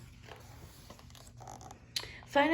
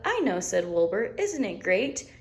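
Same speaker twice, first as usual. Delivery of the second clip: delighted little lamb. Acted. Wilbur catchphrase.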